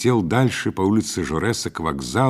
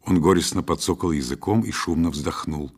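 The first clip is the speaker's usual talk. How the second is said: ordinary delivery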